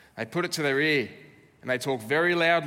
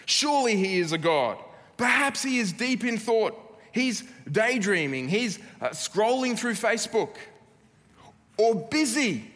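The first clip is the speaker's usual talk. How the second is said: mocking taunt; 7 semitones higher, 3 dB louder than usual. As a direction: jeer at them, egging them on. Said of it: "Elijah's mocking them".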